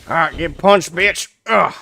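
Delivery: loud, high taunt at the enemy; shouting. Real, not acted. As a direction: yell it as you land the punch. Punching an enemy in game.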